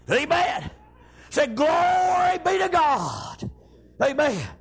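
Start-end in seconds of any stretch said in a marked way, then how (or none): 1.5-3.5 long, drawn-out praise shout; preacher imitating a joyful shout; shouting glory. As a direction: shout it out, stretching the first word. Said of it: "He's just shouting her out".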